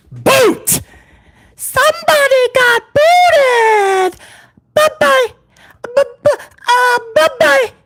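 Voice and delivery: high-pitched hype announcer crowing; drawn-out, high mocking send-off; gleeful taunt. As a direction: crow over someone thrown out.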